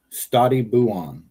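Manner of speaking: slow Stati buon with Stati stressed; mock Italian farewell; Italian phrase. Shown as a demonstration. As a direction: lean on Stati, stretch buon. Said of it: "Stay well, cousin, is what that means".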